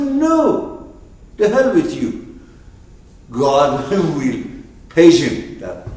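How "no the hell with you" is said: high, loud dismissive retort; Moses brushing him off. Retold or imitated. Moses telling the pestering guy to go away, high and loud.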